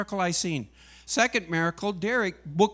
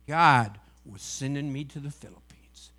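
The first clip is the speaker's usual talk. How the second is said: ordinary talk, nothing marked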